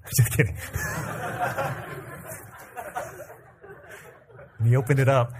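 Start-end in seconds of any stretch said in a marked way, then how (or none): 0.0-0.6 real chuckle through the words; amused, breathy giggle while joking; laughing. Real, not acted. Laughs at his own joke. 0.6-2.4 soft airy laugh, high up; real amused giggle at his own joke; unvoiced high giggle. Real, not acted. Laughs after Jared joke.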